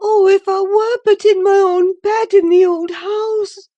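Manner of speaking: high, loud, bitter lament; bitter longing. A narrator's reading. Catherine longs for Wuthering Heights, high bitter lament. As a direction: ache for the old home. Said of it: "she went on bitterly".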